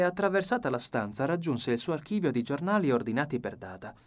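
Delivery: ordinary delivery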